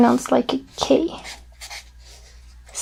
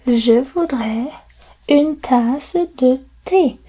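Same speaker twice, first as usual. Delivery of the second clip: Je voudrais une tasse de thé said slowly; slow model sentence; slower than usual. Shown as a demonstration. Teacher drills the phrase slowly for learners. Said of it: "I would like a cup of tea".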